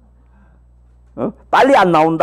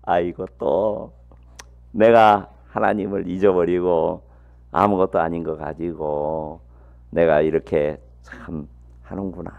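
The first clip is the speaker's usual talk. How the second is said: rueful muttering to himself; 11 semitones lower, 5 dB quieter than usual. Retold or imitated. Preacher retells his own muttering.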